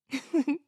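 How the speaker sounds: a light high giggle; short laugh. Real, not acted. Laughs after reading.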